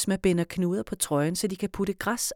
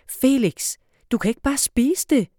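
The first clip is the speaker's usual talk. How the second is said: higher and louder scolding shout; 7 semitones higher, 6 dB louder than usual. Acted. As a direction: Esma yelling at Felix eating the last apple, loud scold. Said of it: "råber Esma".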